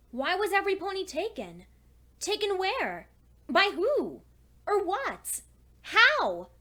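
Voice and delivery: anxious questions piling up toward a cry; rising distress. Acted. Alone in an empty town, her questions climb toward a frightened cry. Asking where everyone went.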